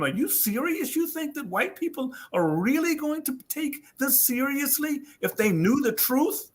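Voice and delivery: retold disbelief, pitched well up; incredulous challenge. Telling how he questioned his friend's belief, incredulous.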